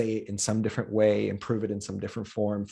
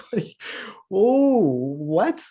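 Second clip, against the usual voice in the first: high, loud burst of astonishment; shocked disbelief; 10 semitones higher, 7 dB louder, slower than usual. Acted. Comic reacting to friends' remark, astonished.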